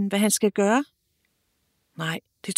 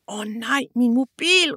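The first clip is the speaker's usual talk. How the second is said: startled cry rising higher on min mobil; shouting in alarm; 3 semitones higher than usual. Acted. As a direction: call out as it hits you. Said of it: "råber han". (forskrækket råb)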